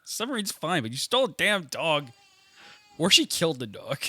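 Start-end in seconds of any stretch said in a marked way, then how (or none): none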